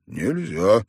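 plain reading voice

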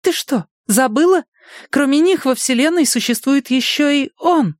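lightly voiced eager girl; young woman voice. A narrator's reading. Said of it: "А А схватила подругу за руку".

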